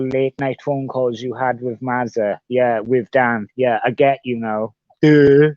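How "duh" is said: mock duh. Real, not acted. Mocking his own slowness.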